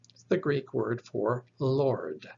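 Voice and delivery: ordinary talk, nothing marked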